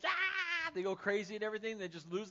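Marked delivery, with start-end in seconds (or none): 0.1-0.7 high, loud, excited shriek from a crowd; crowd scream. Retold or imitated. Family seeing their new house, screaming with excitement. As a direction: let out a thrilled group scream. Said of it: "the family goes".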